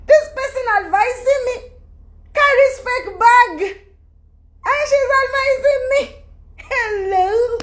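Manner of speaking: high, laughing disbelief; incredulous laughing. Real, not acted. Vlogger mocking a critic with a fake bag, laughing disbelief. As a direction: laugh at the absurdity.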